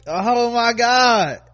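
high, loud, long-drawn oh my God; exasperated, high-pitched exclamation; drawn-out dismay. Real, not acted. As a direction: stretch it out, aghast. Reacting aghast to news.

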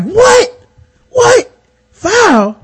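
high-pitched, loud imitation of disbelief; mock incredulity. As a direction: squawk it like an outraged opponent. Acting out opponent's disbelief at call.

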